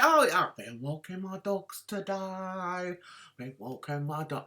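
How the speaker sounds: low, soft mocking drone; Peter Mon impression. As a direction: mimic a dull vlogger, dropping low and quiet. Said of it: "He said".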